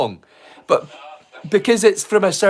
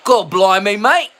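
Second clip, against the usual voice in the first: put-on English accent, matey; 5 dB louder than usual. Shown as a demonstration. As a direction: do a hammed-up English "mate" accent. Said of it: "talked to you in an English accent".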